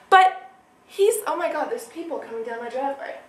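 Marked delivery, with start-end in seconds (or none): none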